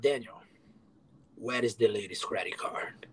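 hushed, breathy question to Daniel; whispered. Retold or imitated. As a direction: whisper it like a worried manager. Manager questioning him.

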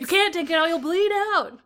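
high, loud hammed-up warning; comic yelled alarm; mock-panicked protest. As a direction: shout it like a frantic objection. Acts out objection in joking skit.

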